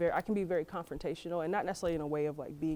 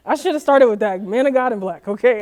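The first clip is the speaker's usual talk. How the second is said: loud, high, laughing insistence; emphatic declaration; 6 semitones higher, 17 dB louder than usual. Real, not acted. On a dating show, insisting on a man of God, loudly. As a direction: belt it out like the point is obvious.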